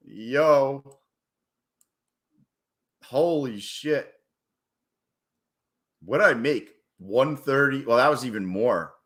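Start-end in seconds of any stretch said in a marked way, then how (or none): none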